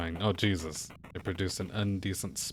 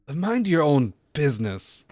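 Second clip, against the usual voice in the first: loud, higher angry brush-off; 4 semitones higher, 7 dB louder, slower than usual. Acted. Delinquent tells the old man to scram, loud and angry. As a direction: shove him off with the voice.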